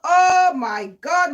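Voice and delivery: drawn-out mock horror at her own people; shocked gasp. Telling the client's remark, she replays her shocked reaction.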